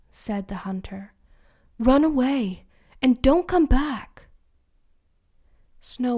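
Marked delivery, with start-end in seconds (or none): 1.8-4.3 urgent warning. Acted. Hunter urges her to flee.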